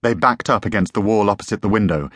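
ordinary delivery, nothing marked

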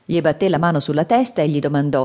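ordinary delivery, nothing marked

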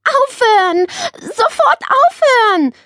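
high, loud cross cry; sour, angry shout. A narrator's reading. Nele to the crowing parrot, shouting angrily. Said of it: "rief Nele sauer".

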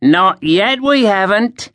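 put-on high, jeering young soldier; mocking guard. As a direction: jeer at him. Grinning guard threatens.

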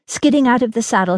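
ordinary delivery

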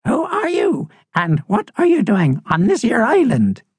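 put-on high-pitched, swooping old-bird voice; old crusty seabird. Old bird challenges intruders on her island, high and crusty. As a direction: question strangers in a high character voice.

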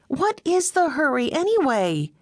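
high, lightly indignant Meg; protesting. A narrator's reading. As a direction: lift into Meg and push back a little. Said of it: "she protested".

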